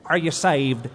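a theatrical TV preacher's question, drawn out; imitating the evangelist. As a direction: put on the evangelist's stage voice. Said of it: "That's how he talked".

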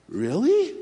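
high disbelieving question; can't believe it; surprised. Retold or imitated. Preacher hears coworker's news, high surprised question. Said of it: "And I'm like".

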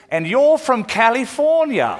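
put-on high, loud English-accented punchline; mock English shopkeeper. English counterman catching out a disguised American, mock knowing English voice. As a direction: play the English counterman seeing through the disguise. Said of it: "He goes".

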